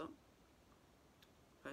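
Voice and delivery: hushed aside to camera; whisper. Real, not acted. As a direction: breathe it softly. Showing the powder close.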